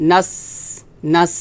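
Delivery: নাস with a long hissing s; নাস ending in sustained airflow; airy hams. Shown as a demonstration. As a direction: let air flow out on the s of নাস. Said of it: "এভাবে বাতাস বের হবে".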